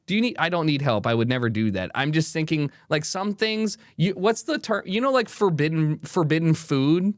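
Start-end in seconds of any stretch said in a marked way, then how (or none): none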